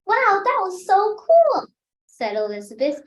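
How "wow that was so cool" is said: excited. Acted. Girl's excited exclamation.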